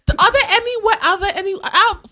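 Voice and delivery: laughing through the question, high and loud; ze śmiechem. Real, not acted. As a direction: crack up while trying to ask. Breaks up laughing asking the question.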